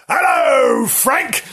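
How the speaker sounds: high, booming drawn-out greeting; very loud voice. Acted. Idiot sister greeting the frightened puppy, very loud. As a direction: belt out the hello, pitched right up. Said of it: "in a very loud voice".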